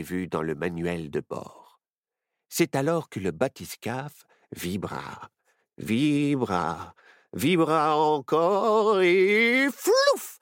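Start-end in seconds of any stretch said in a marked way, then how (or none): 4.5-10.4 slow, drawn-out, rising to a loud flouf; building to a burst. A narrator's reading. Narrator building as the sub shakes, slow then loud flouf. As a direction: stretch it out then pop the flouf.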